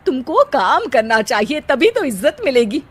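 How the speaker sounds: usual speaking voice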